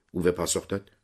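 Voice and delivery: ordinary delivery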